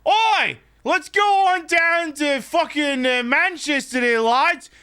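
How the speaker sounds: a hammed-up pub-lad British accent that keeps drifting Australian; British accent sliding into Australian. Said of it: "not trying to do a British accent, but it coming out Australian".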